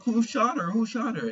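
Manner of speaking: frantic bystanders; panicked crowd. Retold or imitated.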